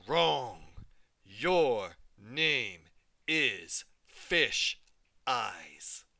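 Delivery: very slow, drawn-out growling taunt; growly bully. Acted. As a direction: stretch each word to mock her. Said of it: "She had a deep, growly voice".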